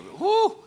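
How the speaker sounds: a high, loud whoo over the onion smell; whoo of relief. Real, not acted. Onion smell overwhelms pastor.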